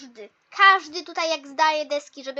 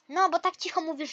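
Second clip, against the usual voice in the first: usual speaking voice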